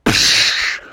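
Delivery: mouth-made whoosh of spray; bursting splash noise. Acted. Bear shoots out of the sea, hissed splash noise. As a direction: hiss out a sudden splash. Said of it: "they just saw him go".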